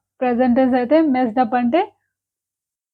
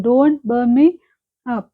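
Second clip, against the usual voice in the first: ordinary talk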